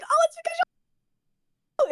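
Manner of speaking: high, nervous squeak; nervous excuse. Retold or imitated. Making an excuse to the ex, high and nervous.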